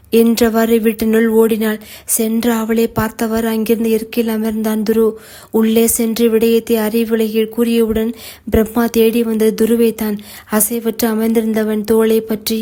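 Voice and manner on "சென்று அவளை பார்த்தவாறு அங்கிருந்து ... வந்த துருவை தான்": ordinary delivery, nothing marked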